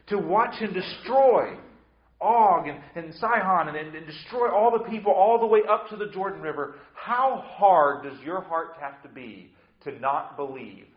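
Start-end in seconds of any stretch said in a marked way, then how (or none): 0.1-9.5 fervent preaching. Real, not acted. Preacher building fervent climax.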